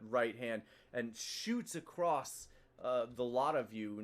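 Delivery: ordinary talk, nothing marked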